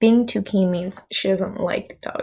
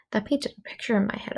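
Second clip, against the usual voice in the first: whisper; 5 dB quieter, faster than usual. Real, not acted. Aside whispered, fast.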